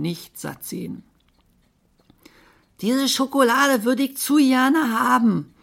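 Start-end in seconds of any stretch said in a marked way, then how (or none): 2.8-5.5 high, loud monkey rejoicing; jubilant squeal. Acted. Monkey craving chocolate at the shop window, jubilant. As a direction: whoop with longing as the monkey. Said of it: "jauchzte der Affe".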